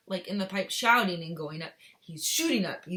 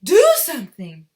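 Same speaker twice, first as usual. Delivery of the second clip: harsh whisper-scream; 5 semitones higher, 19 dB louder than usual. Acted. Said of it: "Missus Gloop screamed".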